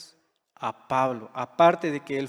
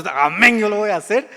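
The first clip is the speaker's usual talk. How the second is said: a louder, hearty mock Amén; the worship pastor answering God, in a lightly mocked big voice; imitating Pastor Rollis; 4 semitones higher, 7 dB louder than usual. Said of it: "Con esa voz".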